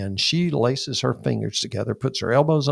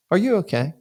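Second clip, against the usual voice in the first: lady's concern; 8 semitones higher, faster than usual. Retold or imitated. Woman on stairs asks gently.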